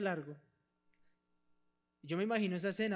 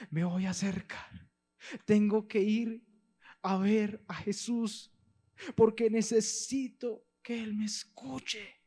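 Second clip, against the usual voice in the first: slow, loud, urgent resolve; rising determination; 4 semitones higher, 6 dB louder, slower than usual. Acted. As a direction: push forward with growing urgency. Bartimeo resolves to go.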